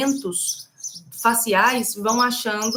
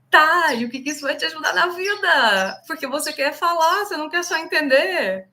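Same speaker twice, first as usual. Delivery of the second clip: pointed challenge; 6 semitones higher than usual. Real, not acted. Challenging the passive learner.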